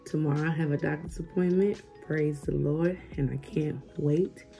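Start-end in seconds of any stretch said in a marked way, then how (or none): none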